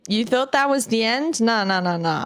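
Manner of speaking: usual speaking voice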